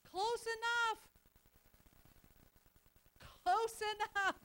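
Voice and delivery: high, loud "Close enough" with a grin; cheeky triumphant shout; gleeful exclaim. Retold or imitated. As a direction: sell the punchline with glee. Punchline of million dollar story.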